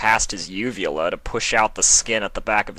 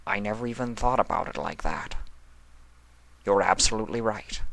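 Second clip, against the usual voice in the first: softer, fast, disbelieving; a humbled god conceding quietly; stunned quiet; 5 dB quieter, faster than usual. A narrator's reading. Discord, stunned, quietly admits Sombra is right. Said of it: "disbelief written all over his face".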